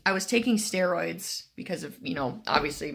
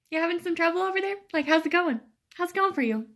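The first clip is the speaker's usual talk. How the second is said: much higher, fast mock-sweet question; sarcastic teasing; 10 semitones higher, faster than usual. Patient teasing the struggling nurse, sarcastic and high. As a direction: needle him with fake concern.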